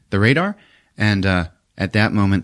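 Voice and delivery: ordinary talk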